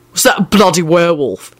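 brusque barked question. A narrator's reading. Man questioning a woman who had cried, barked. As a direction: bark the question at her. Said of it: "he barked out".

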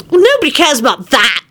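put-on high boyish voice, blurting rudely; lightly voiced rude child. A narrator's reading. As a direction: cut in like a cheeky kid. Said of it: "blurted out rudely".

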